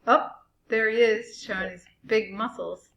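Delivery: usual speaking voice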